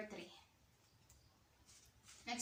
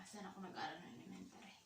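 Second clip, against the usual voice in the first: quick softer whisper, thinking aloud; whisper to herself; 3 semitones lower, 9 dB quieter, much faster than usual. Real, not acted. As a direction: murmur the question to yourself, fast. Rereads question to herself.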